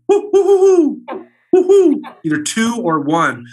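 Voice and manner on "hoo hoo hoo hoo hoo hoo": high, loud owl hooting; female great horned owl hoots. Shown as a demonstration. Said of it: "The female goes".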